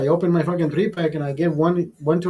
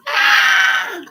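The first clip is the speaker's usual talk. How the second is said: put-on high piercing scream of a little kid; child's shriek; 5 dB louder than usual. Imitating the neighbor's little kid in the pool, a piercing shriek. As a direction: shriek like a toddler jumping in the pool. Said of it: "when they go in the pool".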